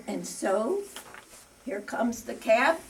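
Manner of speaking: ordinary talk, nothing marked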